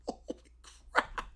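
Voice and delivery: breathy soft laugh into a whisper; under-breath amazement; hushed holy crap. Real, not acted. Stunned aside.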